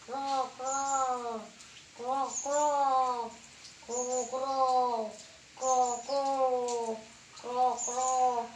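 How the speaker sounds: long croaks in pairs; imitated frog croaking; frog imitation. Shown as a demonstration.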